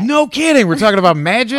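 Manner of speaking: high, loud sarcastic astonishment; mock surprise. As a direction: act amazed to tease her.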